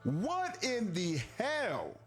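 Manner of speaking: ordinary talk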